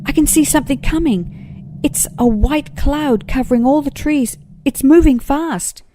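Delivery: high, loud tiny possum voice; crying out. Acted. Blossom warning friends below, high loud alarmed call. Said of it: "Blossom cried out".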